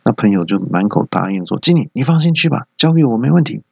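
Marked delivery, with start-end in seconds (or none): 1.6-3.6 eager promise. Acted. Friend eagerly promises manager.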